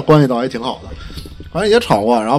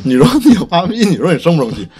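louder, higher furious venting; angry outburst; 6 semitones higher, 7 dB louder than usual. Real, not acted. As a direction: vent the anger at the listeners. Angry outburst recalling fight. (气愤地大声抱怨)

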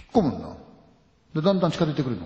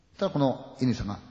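ordinary delivery

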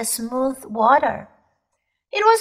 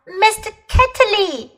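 higher, sudden call of the name; shouted name; 8 semitones higher than usual. A narrator's reading. Polly suddenly remembers and shouts the name out. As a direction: blurt the name as memory snaps back. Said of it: "she shouted out".

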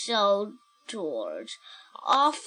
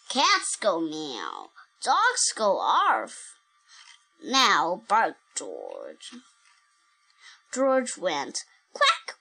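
put-on reading to a toddler; 2 semitones lower than usual. Reads picture book aloud.

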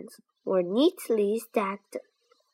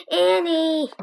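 calling out a name, louder and higher; 8 semitones higher, 5 dB louder than usual. Acted. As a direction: raise the voice to reach her. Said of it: "he said a little louder".

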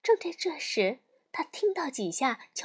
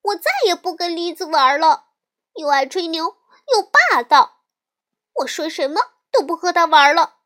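loud, higher, indignant rant; little sister loudly complaining to mother; loud complaint; 4 semitones higher, 8 dB louder than usual. Acted. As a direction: vent to mother at full volume. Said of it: "小熊妹妹大声地说".

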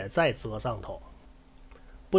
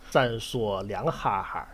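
usual speaking voice

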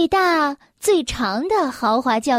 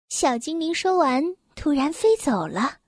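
plain reading voice

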